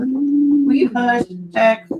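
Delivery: high, very slow drawn-out hush; sing-song telling the dog to hush; playful drawn-out scold to the dog; pet scold. Real, not acted.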